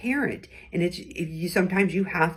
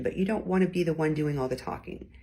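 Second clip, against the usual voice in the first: normal talking voice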